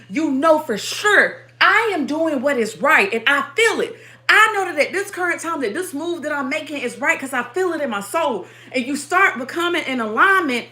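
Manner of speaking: loud, rhythmic, hammering sermon delivery; fervent preaching. Acted. Preaching to her stream audience, fervent and rhythmic. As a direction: preach it with fire to the congregation. Preaching to viewers at full force.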